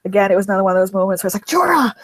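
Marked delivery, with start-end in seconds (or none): 1.4-1.9 "Jorah!" called out high and delighted; excited cry. Retold or imitated. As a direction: call out Jorah's name in a burst of joy.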